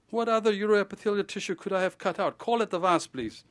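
rising exasperation, then a clipped please; exasperated reply. Retold or imitated. Narrator writing back to the pathologist, fed-up and exasperated. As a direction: sigh it out as if to a fool.

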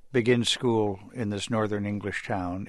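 ordinary talk, nothing marked

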